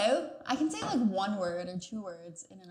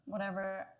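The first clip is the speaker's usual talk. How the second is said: ordinary talk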